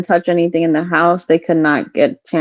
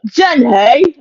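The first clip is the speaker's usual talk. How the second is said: Forrest Gump impression; 7 semitones higher, 4 dB louder than usual. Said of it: "It sounds like Forrest Gump".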